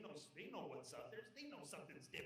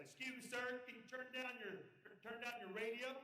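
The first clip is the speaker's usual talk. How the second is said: loud, high-pitched official request; Border Patrol agent; 6 semitones higher, 5 dB louder than usual. Acted. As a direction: call out over a car radio like an officer. Border agent confronts driver, acted.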